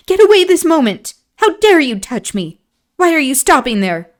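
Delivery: high, loud cry of disgust; sharp disgusted outburst. A narrator's reading. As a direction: recoil from him in disgust. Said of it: "she cried in a tone of disgust".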